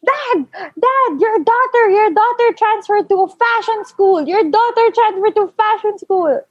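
high-pitched, urgent mock wail; tattling mother. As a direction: imitate mom raising the alarm to dad. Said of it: "my mom was like".

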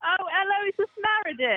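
high, lilting put-on British accent; mock British accent. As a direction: answer like a posh British lady, hammed-up. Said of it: "do a British accent when she answers".

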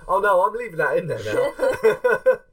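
high, laughing protest. Real, not acted. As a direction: laugh while teasing back. Laughing at the rude outburst.

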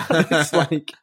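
laughing. Real, not acted. Laughs into the line.

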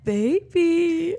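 high, drawn-out mock cooing; baby voice. Imagining someone talking like a baby, mock baby voice. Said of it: "talking in a baby voice".

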